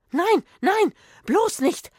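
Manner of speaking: high, loud cry of warning; frantic old woman crying out; alarmed plea. A narrator's reading. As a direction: stop them in a panic, voice shooting up. Old woman frantically stops them going in.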